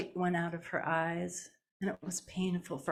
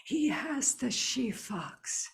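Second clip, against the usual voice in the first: put-on old healer; 4 semitones higher, much slower than usual. A narrator's reading. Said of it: "And old Duck Egg says".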